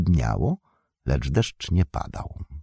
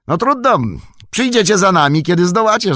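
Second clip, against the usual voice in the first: high, loud shout over the shoulder; breezy high-pitched holler; calling back; 13 semitones higher, 5 dB louder than usual. A narrator's reading. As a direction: call out to those left behind. Merry calls back to tired escort.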